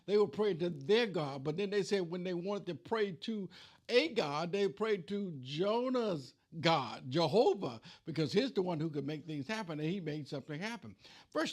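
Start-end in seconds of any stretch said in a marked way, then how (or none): none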